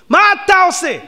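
indignant shout at the mourner; loud. Shown as a demonstration. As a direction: demand מה אתה עושה with force.

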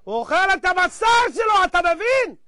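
high, emphatic outcry; impassioned repetition. A narrator's reading. Preacher driving the parable home to listeners, impassioned cry. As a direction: raise the pitch sharply and drive it home.

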